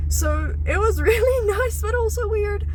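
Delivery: high, giggly, laughing through the words. Real, not acted. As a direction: laugh as you say it. Laughing about the therapy dog.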